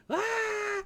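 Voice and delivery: very high mock baby yell; imitated baby scream. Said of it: "when he was grumpy and screaming".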